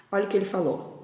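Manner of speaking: plain reading voice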